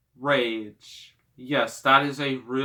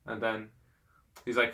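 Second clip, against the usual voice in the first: ordinary talk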